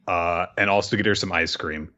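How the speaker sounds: ordinary talk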